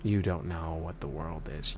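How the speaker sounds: low, soft, patronizing imitation; condescending mock voice. Imagined viewer scolding Isaac in a low, soft patronizing voice. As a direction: talk down like a smug elder, deep and hushed. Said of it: "some people might be saying".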